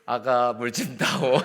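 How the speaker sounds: slow, put-on old father-in-law voice; playful father-in-law. Father-in-law asking his new daughter-in-law for water, slow hammed-up. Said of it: "이거를 이제 이상하게 하고 싶더라고요".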